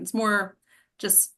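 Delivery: ordinary talk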